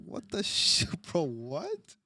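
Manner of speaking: high, breathy half-laugh of disbelief; laughing shock. Retold or imitated. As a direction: blurt it out, cracking up in shock. Reliving shock at meeting governor.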